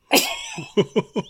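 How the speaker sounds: high squealing laughter; can't stop laughing; helpless high laugh. Real, not acted. Breaks up reading the profile.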